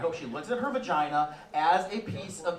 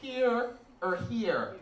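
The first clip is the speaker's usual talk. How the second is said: put-on higher, slow child's voice; kid repeating rules; 7 semitones higher, slower than usual. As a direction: recite the rule back like a dutiful little girl. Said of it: "She goes".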